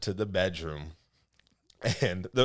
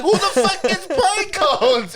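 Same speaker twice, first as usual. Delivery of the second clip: high, slow, drawn-out comic shout; mock yelling; 10 dB louder, slower than usual. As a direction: bellow it in mock outrage through laughter. Retold row over the pine cones.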